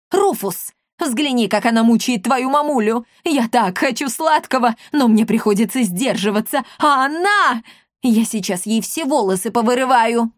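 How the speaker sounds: put-on high-pitched melodramatic old woman; tantrum. Old woman complains to her son about sweets, hysterical.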